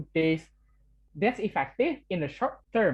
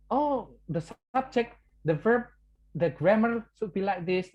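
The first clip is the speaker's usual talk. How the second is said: mock strict teacher; 4 semitones higher than usual. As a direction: play a fussy grammar teacher pouncing on mistakes, lightly. Teacher correcting grammar, imitated.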